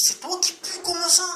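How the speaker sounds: high, shocked mock retort; disgusted surprise. Quoted retort, much higher.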